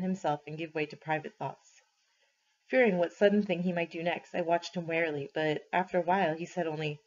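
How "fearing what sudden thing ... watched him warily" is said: ordinary delivery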